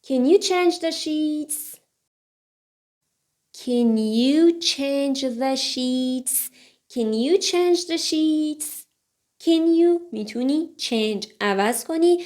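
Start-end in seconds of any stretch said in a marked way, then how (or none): 3.6-6.5 slow, drawn-out learner's model; slow model reading. Shown as a demonstration. Teacher repeats the books sentence for learners, slowly. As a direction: give each word room, slowly.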